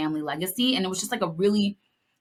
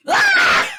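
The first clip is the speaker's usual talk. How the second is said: high, loud anguished shriek; anguished scream; 8 dB louder than usual. Real, not acted. Podcaster reacting to the fridge scene with a shriek. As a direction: scream like your heart just broke.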